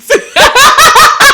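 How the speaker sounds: high burst of laughter. Real, not acted. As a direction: break into a loud laugh.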